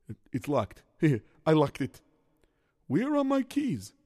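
ordinary talk

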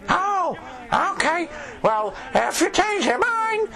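high silly voice. Acted. Peasant invites priest.

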